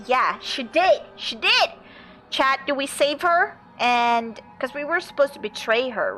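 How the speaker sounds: ordinary delivery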